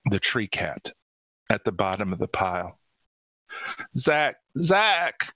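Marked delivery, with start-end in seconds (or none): 4.0-5.3 high, distraught cry of a name; acted tearful calling; sobbed. A narrator's reading. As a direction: call to him, overwrought. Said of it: "Cordelia sobbed".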